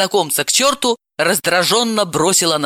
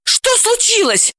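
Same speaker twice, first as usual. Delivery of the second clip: very high, alarmed question; frightened, pitch shooting up; panicked. Acted. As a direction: cry it out in sudden panic. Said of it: "запаниковала Далила".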